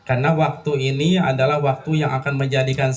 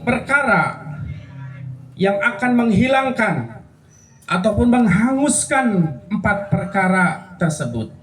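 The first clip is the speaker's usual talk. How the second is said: fiery preaching; 7 semitones higher than usual. Acted. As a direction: preach it out to the congregation. Preaching at full force.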